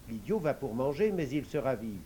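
plain reading voice

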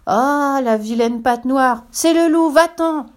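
high, loud chorus of alarm; high-pitched indignant cry; kids crying out. A narrator's reading. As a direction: shout the intruder away in a child's high voice. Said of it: "crient les biquets".

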